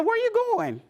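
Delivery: lifted, bright question; high friendly call. Retold or imitated. Daughter calling out to her wandering mother, high and bright.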